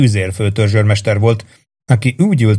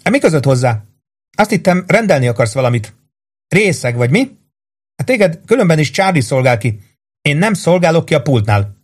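higher, indignant rebuff; angry retort; 6 semitones higher than usual. A narrator's reading. Rose refuses to serve Warden at the counter, angrily. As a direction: snub him with irritation. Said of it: "Azzal dühösen sarkon fordult".

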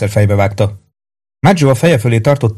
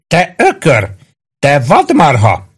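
raised-pitch name-calling; shouted insults; 6 semitones higher, slower than usual. A narrator's reading. Maggio insults the policeman he punches, high acted shout. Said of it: "kiáltotta Maggio".

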